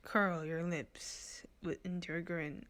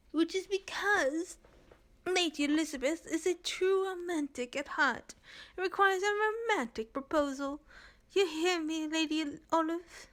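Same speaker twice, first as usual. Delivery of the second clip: high Renza voice; 10 semitones higher, 4 dB louder than usual. Acted.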